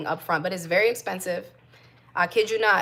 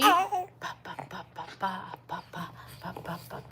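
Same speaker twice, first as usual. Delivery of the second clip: much softer rhythmic ba-ba while bouncing; 7 dB quieter than usual. Real, not acted. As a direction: murmur a bouncing lullaby babble. Bouncing the baby.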